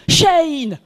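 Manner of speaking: shout. Retold or imitated. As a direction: yell it to the far end of the pitch. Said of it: "I shouted".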